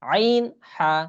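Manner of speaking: Arabic throat letters demo; throaty ain and ha. Shown as a demonstration. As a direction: breathe হা out from the throat. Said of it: "একদম অনেক ভিতর থেকে বলতে হবে".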